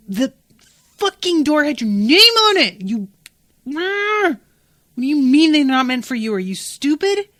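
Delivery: furious disbelief, loud; exasperated outburst. Real, not acted. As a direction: blow up at the foolish character.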